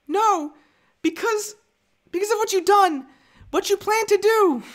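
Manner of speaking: halting mock-tragic falsetto; Padme. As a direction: plead in a put-on high feminine voice. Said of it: "my Padme impression?".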